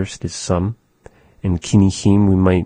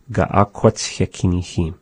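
usual speaking voice

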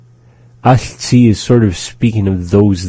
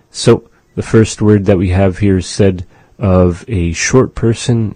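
normal talking voice

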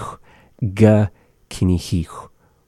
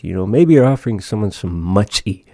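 ordinary delivery

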